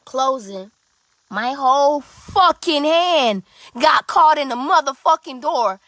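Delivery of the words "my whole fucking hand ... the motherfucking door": angry. Retold or imitated. Hand caught in door.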